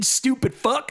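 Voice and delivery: normal talking voice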